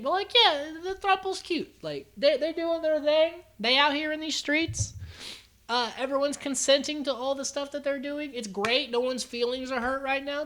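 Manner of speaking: high-pitched, airy commentary; high, sing-song reassurance; playful approval. Real, not acted. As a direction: pitch it way up, teasingly upbeat.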